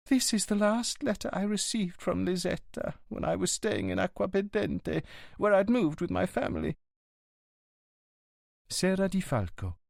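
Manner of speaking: high signora voice reading fondly; signora reading letter. Acted. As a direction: lift into her voice, tender remembrance. Signora reads old letter aloud.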